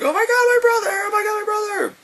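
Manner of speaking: very high shrieking imitation; mock girl's wail. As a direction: shriek it as the distraught sister. Acting out the girl's wailing.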